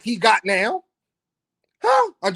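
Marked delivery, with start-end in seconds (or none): 1.8-2.1 sharp high huh; mock huh. Host challenging viewers with a high mock huh. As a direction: toss it up like a challenge.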